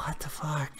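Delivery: whispered what the fuck; whispered disbelief. Real, not acted. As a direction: breathe it out in a whisper. Aside in disbelief.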